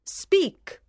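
a judge-like queen ordering; commanding queen. A narrator's reading. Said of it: "said the Queen".

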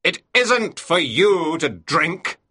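loud, slow, scolding captain; angry shout. A narrator's reading. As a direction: hammer it out slowly at a sailor. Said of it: "he shouted".

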